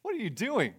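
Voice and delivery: high-pitched, incredulous challenge; Paul confronting Peter. Acted. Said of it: "And Paul says".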